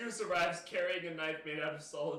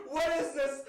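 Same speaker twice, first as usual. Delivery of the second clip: higher and louder, incredulous; asking in disbelief, half laughing; amused disbelief; 7 semitones higher, 6 dB louder than usual. Real, not acted. Laughing disbelief at game text.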